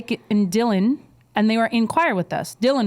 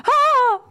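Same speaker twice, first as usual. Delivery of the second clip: high startled scream; mock shriek; 5 dB louder than usual. The clerk shrieks when grabbed, hammed-up high scream.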